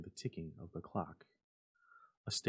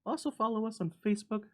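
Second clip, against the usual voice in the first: cheery sign-off; 12 semitones higher, 4 dB louder than usual. Real, not acted. Cheerful outro to viewers.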